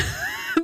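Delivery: high-pitched burst of laughter; short, high laugh; real laugh. Real, not acted. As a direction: crack up briefly at your own remark. Laughs at own warning.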